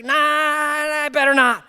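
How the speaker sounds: high, loud, drawn-out nervous refusal; mock-anxious wavering no. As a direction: imitate a rattled technician backing off. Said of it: "he's so nervous".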